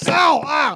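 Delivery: high little yelps of pain; mock pain. Uncle playing Pharaoh stumbling, mock ow. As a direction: yelp as you stumble in the dark.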